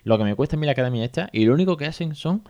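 rushed and fed up, fast; hurried, annoyed friend. Retold or imitated. Acquaintance leaving lunch in a rush, complaining fast about the academy. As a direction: rattle it off like you're late. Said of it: "iba como con prisa".

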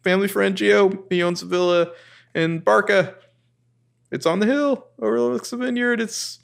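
high, gushing pitch of a dreamy plan; eager Ezra. Retold or imitated. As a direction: sell the Tuscan villa with giddy enthusiasm. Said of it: "And Ezra's like".

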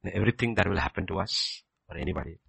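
usual speaking voice

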